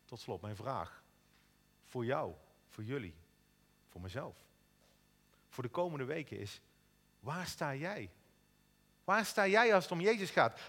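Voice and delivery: ordinary talk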